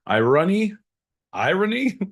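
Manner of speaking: "irony" lifted high in a rising questioning tune; questioning inflection. Shown as a demonstration. Host playing with inflection, "irony" said as a high rising question. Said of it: "That's a little infection".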